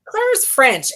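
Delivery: playful Irish lilt on Claire; mock Irish accent. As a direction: keep the light Irish accent going. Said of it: "and I'm speaking Irish".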